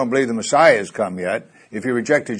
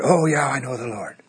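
imitating patient; 2 semitones higher than usual. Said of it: "they're freaking out".

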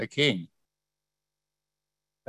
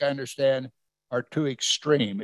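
ordinary talk

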